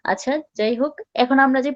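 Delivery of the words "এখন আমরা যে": ordinary talk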